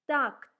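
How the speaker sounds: "tact" repeated loud, unaspirated T; teacher repeating the word loudly; repeating for students. Shown as a demonstration. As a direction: model "tact" again for students to copy. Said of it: "let's try to read the first Russian words".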